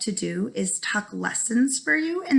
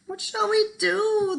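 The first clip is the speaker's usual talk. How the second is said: high, slow, moaning plea; wailing villagers; 10 semitones higher, slower than usual. A narrator's reading. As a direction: wail it in despair. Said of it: "the villagers wailed".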